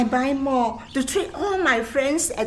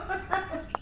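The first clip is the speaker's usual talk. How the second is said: light laugh; 9 semitones higher, 9 dB quieter than usual. Acted. Teasing the hawkers.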